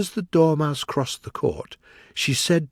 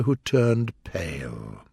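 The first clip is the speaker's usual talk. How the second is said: usual speaking voice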